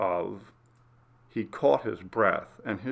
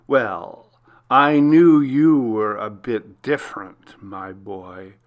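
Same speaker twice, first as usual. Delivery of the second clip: slow, louder, gloating villain voice; menacing killer's letter; 5 dB louder, slower than usual. Acted. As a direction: savour every word like a taunting stalker. Villain's letter read aloud.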